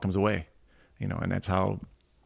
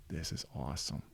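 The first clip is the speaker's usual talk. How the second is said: awed; 4 semitones lower, 11 dB quieter than usual. Retold or imitated. Awed while Irvin tells stories.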